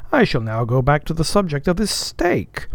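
high-pitched, mock-formal questioning; officer playing the examiner; teasing second mate. A narrator's reading. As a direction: quiz the cook with swooping pitch. Stubb questions the cook.

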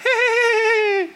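a high little giggle, like the twins behind the door; high giggle, kids laughing at dad; imitated toddler giggle. Preacher mimics his twins giggling behind the locked door, high giggle. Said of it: "they're giggling".

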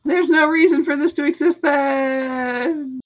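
high, slow crying shout; hammed-up anguished cry; mock wail. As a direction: wail in fake despair at the useless reflex. Mock despair over useless stat.

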